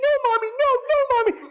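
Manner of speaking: put-on very high childlike pleading scream; child screaming. Little boy pleading with his mother, high child's scream. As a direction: scream like a terrified small boy. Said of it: "he screams".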